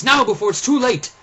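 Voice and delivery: loud, high urgent cry; urgent shout. Acted. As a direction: hurry him before the soldiers come. Father urges escape.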